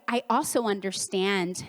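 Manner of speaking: ordinary talk, nothing marked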